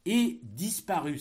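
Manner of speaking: slow, loud dictation voice; dictating. Shown as a demonstration. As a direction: dictate it slowly and loudly. Dictation of text chunk.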